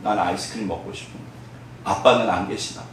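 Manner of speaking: soft, low and hurried; a little orphan doubting his dad, quietly; forlorn child. Acted. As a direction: wonder aloud like a sad child. Lonely child wonders about father.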